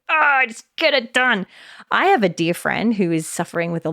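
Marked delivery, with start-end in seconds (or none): 0.1-1.5 impatient push. Retold or imitated. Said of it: "And you're like".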